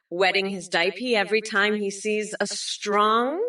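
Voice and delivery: normal talking voice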